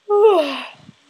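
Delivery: loud, high, falling sigh; heavy sigh. Acted. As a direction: let out a big sigh before sleep. Monologue ends, back to sleep.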